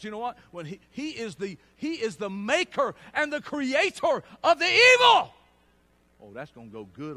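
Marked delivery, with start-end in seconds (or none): 1.8-5.3 loud, slow, ringing preacher climax; preaching shout. Real, not acted. Preacher booming to the congregation, loud and slow. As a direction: hammer it home to the congregation.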